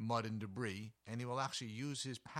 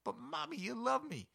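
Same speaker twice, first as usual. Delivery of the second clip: put-on much higher pleading child voice; whiny kid. Kit pleads with Mom who pushed it out, much higher whine. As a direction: beg like a pushed-out baby. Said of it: "pop back in and go".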